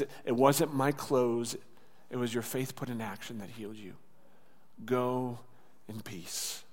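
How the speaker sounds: soft, low, settling almost to nothing; gentle reassurance. Acted. Jesus telling the woman her faith healed her, gentle. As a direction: ease her worry, ending very softly.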